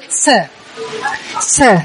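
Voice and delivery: "Sờ" as English TH, tongue touching upper teeth. Shown as a demonstration. Teacher isolating the TH of path, "Sờ" with tongue on upper teeth. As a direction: release "Sờ" from tongue against top teeth. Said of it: "cái răng trên là mình đụng cái lưỡi".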